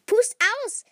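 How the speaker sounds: high, loud cheer; excited urging. A narrator's reading. Brother holding out the cake, excited urging. Brother urges candles blown out.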